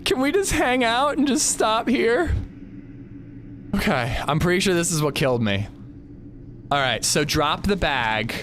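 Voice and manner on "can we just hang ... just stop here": high, slow, whiny begging; scared pleading. Real, not acted. Scared player begging at a ledge.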